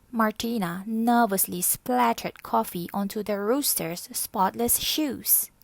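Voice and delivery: ordinary delivery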